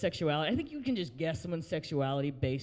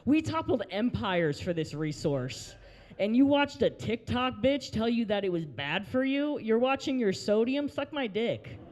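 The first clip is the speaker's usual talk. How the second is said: comic rant; 5 semitones higher, 3 dB louder, slower than usual. Acted. Mock rant at sister-in-law.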